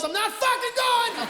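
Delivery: high-pitched mock yell; imitating a kid's defiant outburst; retold yell. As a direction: screech it like a refusing pupil. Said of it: "He yells".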